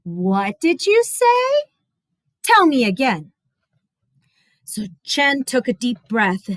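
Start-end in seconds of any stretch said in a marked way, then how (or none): 0.0-3.3 disbelief. Acted. Disbelieving mother.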